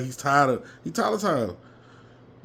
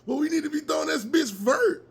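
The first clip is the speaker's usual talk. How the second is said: much higher hollered line; yelled demand; 13 semitones higher than usual. Retold or imitated. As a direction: yell the play call at the coach. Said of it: "I was yelling like hell".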